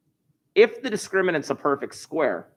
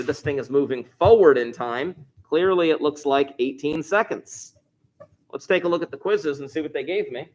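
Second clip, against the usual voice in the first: ordinary talk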